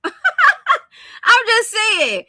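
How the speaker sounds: loud, high laughing aside. Real, not acted. Joking to viewers about the whole borough, laughing. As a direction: laugh hard, then protest through it.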